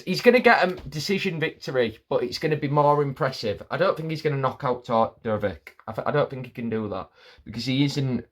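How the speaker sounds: usual speaking voice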